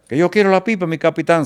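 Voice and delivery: high, pleading imitation of an eager sergeant; acted-out excited demand in Spanish; insistent demand. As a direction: blurt it out, high-pitched and pressing.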